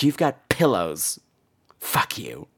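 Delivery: ordinary delivery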